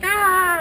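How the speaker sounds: high, loud "aah"; acted wild delighted scream; helpless glee. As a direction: shriek as if nearly falling off. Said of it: "I am laughing so hard".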